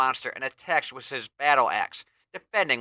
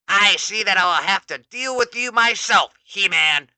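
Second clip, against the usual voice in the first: higher, louder menacing roar; Skeletor roaring; 7 semitones higher, 7 dB louder than usual. Acted. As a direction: threaten the hero yourself. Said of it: "Skeletor roars".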